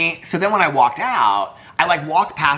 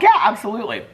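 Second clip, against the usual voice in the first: eager yes; 10 semitones higher than usual. Retold or imitated. Eagerly agreeing to picture.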